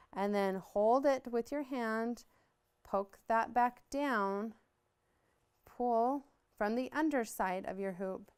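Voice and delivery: slow, deliberate step-by-step teaching; walk them through each move slowly; slow demo. Shown as a demonstration. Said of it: "So we'll do it again".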